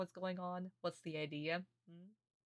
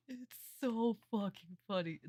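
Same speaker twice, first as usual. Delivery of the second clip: usual speaking voice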